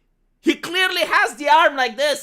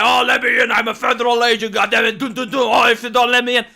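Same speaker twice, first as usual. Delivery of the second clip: mocking big man; 4 dB louder than usual. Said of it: "and plays the big man".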